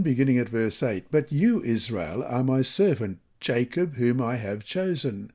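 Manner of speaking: ordinary talk, nothing marked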